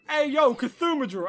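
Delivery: high, loud and slow mock call; teacher mockingly hollering the nickname; teasing teacher greeting. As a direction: call him out across the classroom. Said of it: "He just was like".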